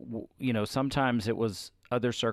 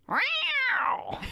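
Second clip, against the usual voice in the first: high burst of laughter; real laugh. Real, not acted. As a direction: let a real laugh break out. Laughing after the quote.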